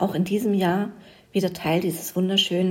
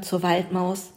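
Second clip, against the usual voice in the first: ordinary delivery, nothing marked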